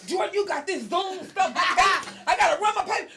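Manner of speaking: loud laughing rant, pitched high; going off on daughter. Retold or imitated. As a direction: blow up at your daughter, half laughing. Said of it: "I'm going off on my daughter".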